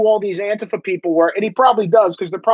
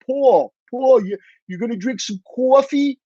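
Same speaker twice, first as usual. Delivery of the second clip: put-on higher-pitched, teasing New York accent; mocking New York accent; 5 semitones higher than usual. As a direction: exaggerate a New York accent to tease Paul. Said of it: "they will make fun of me".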